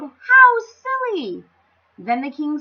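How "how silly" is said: slow, high, loud cry; delighted crowd shout. Acted. Crowd shouts in delight at the silly idea. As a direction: cheer it in delight. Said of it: "shouted the crowd".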